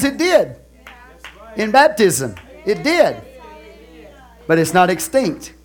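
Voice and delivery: loud, high fervent sermon; full-force preaching. Real, not acted. Pastor exhorts the congregation, loud and fervent. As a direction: preach it hard to the church.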